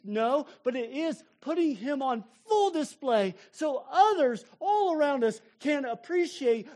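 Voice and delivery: fervent sermon climax; impassioned preaching. Acted. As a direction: build to a tearful climax. Preaching at full force.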